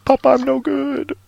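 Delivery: breaking down, begging his papa; despairing Chris. Acted. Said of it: "and then Chris is like".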